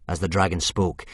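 ordinary delivery, nothing marked

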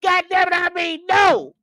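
high, loud, mocking no; no yelled like a stubborn man; mock shouted no. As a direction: bark a flat refusal in jest. Mimicking Earl's refusal, shout.

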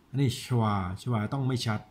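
ordinary delivery

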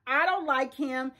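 ordinary talk

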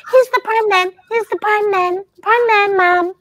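put-on high, excited little kid calling out; child calling to mum, high and excited. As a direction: squeal it like an eager child announcing. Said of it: "Toffee says".

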